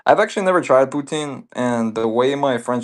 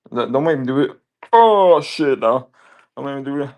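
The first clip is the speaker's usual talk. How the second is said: hyped up; 3 semitones higher, 6 dB louder than usual. Real, not acted. Teasing viewer, hyped.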